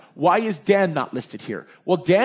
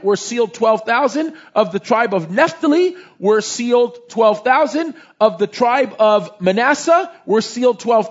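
slow, louder, higher solemn reading of the list; scripture reading; 4 semitones higher, 3 dB louder than usual. Acted. Pastor reading the tribes aloud to the class, slow solemn reading. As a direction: lay out each tribe like a proclamation.

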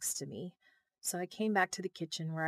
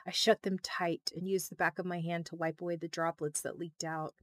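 ordinary delivery, nothing marked

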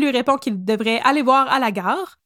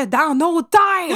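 raised, hammed-up outburst; theatrical alarm, pitched up; mock-dramatic cry; 4 semitones higher, much slower than usual. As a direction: punch it high like a melodrama narrator. Mock-dramatic end of narration.

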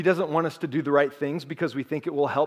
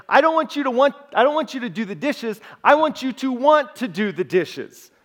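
put-on higher, louder, frustrated girlfriend pleading; exasperated wife; 6 semitones higher, 4 dB louder than usual. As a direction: voice her as fed up and pushing. Wife's frustrated reply in story.

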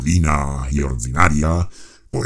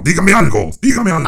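very high, loud shout; 13 semitones higher, 6 dB louder than usual. Acted. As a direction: scream the demand. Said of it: "sabe usted usar este recurso".

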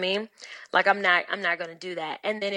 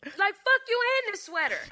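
much higher, sassy echo; mock dismissal; 12 semitones higher than usual. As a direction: toss it off with scorn. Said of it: "echoes friend".

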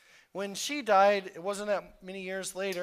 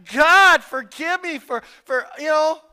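loud, weeping cry to God, reenacted; retold tearful plea; 8 semitones higher, 15 dB louder than usual. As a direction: cry out the plea as if at the altar again. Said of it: "like, I'm crying".

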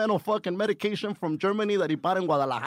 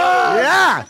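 very high, very loud confirmation; big incredulous-sounding holler; emphatic yell; 13 semitones higher, 12 dB louder than usual. Real, not acted. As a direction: shout it back at a shocked friend. Confirming the shocking offer to friend.

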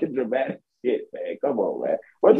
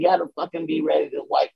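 ordinary delivery

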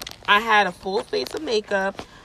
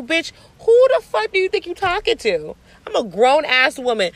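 high-pitched, louder outraged challenge; indignant confrontation; 7 semitones higher, 5 dB louder than usual. Retold or imitated. Telling off the woman who demanded the door, indignantly. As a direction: tell her off with rising outrage.

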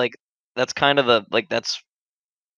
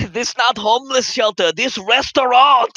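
mock Chinese accent; 9 semitones higher, 4 dB louder than usual. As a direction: scold the diner. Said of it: "Chinese guy yelling".

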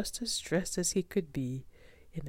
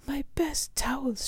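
high, slow cry of dismay; shocked dismay; 11 semitones higher, 4 dB louder than usual. Acted. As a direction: wail it, drawn out and appalled. Dismay at ruined towels.

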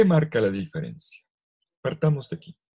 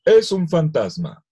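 frightened cry; 7 semitones higher, 7 dB louder than usual. Acted. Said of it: "se asustaron y dijeron".

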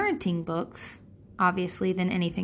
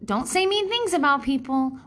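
high, sing-song scolding parent voice; preachy parent; 8 semitones higher than usual. Retold or imitated. Parent telling complaining child to be nice, sing-song. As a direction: chide a child with sugary politeness. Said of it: "we always say".

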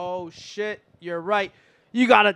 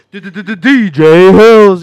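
DJ stutter; 14 dB louder than usual. Acted. DJ drop style name stutter.

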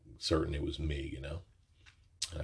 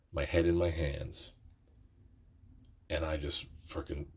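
usual speaking voice

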